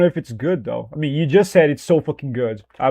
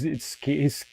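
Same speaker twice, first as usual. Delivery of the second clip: normal talking voice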